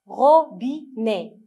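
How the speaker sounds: "robinet" slowly as RO, BI, NET; three separate beats in "robinet"; syllable by syllable. Shown as a demonstration. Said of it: "on va lire ensemble les mots".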